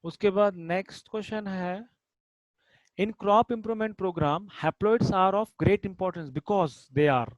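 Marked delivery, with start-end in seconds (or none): 3.0-7.3 drawn-out classroom read-aloud; slow dictation. Shown as a demonstration. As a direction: slowly read out the exam question. Teacher reading question aloud.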